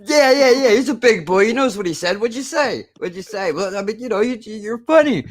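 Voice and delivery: a lightly voiced Pesci pushing and taunting; imitating a volatile gangster. Acting out the funny-how scene.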